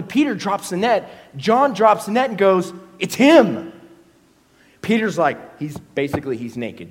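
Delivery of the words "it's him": excited recognition. Acted. John recognizing Jesus.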